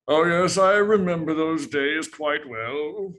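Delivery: put-on wistful British old hero, slowly savoring it; British accent. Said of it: "kind of a British accent".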